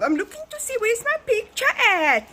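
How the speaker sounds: high, loud, slow squeal; excited. Real, not acted. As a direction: bubble over with glee. Excited searching for photo.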